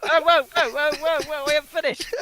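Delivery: high, loud, urgent interruption; urgent whoa. Real, not acted. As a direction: cut in high and loud to stop them. Stopping the host from ending.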